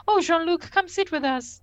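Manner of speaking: high, inviting lady's voice; eager Minuet. Acted. Minuet inviting Jean-Luc to join them, high and eager. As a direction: coax him over in a high, flirty voice. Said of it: "she's fully into it. She's like".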